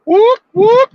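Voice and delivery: high, loud whoop like a siren; police siren imitation. Shown as a demonstration. A driver warning his friend that police are ahead, loud siren whoop. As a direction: belt out a mock cop siren. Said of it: "That's the sound of the police".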